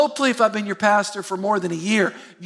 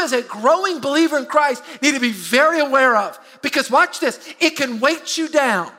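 higher, louder preacher's build to the key point; preaching build-up; 5 semitones higher, 3 dB louder, slower than usual. Acted. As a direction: drive the point home like a pulpit climax. Preaching at full force.

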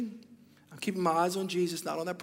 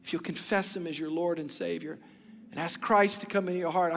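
normal talking voice

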